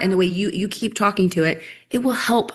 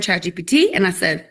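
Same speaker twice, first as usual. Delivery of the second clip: normal talking voice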